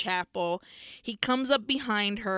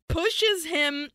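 ordinary delivery

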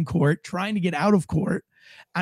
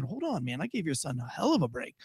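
soft, low retold objection; understated pushback; quiet protest; 4 semitones lower, 10 dB quieter than usual. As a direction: object gently, keeping the volume down. Answering the accusing father.

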